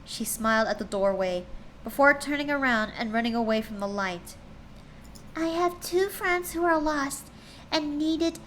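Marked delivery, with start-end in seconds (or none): 5.3-8.4 lightly voiced pleading girl. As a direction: gently ask for help for lost friends. Voices a character in story.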